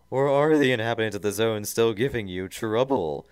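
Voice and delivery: villain voice. Acted. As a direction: sneer like a cartoon tyrant. Robotnik voiced on screen.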